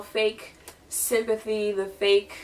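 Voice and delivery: ordinary talk, nothing marked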